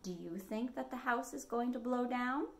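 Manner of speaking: usual speaking voice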